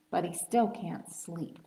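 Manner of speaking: whispered refrain, hushed. A narrator's reading. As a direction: breathe it out like lulling a child. Whispered refrain of bedtime story.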